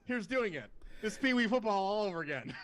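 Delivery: high, loud burst of exasperated realization; mock sudden realization. Player realizing McVay repeats pee wee football, mock outburst. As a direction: exclaim it high, as if it just dawned on you.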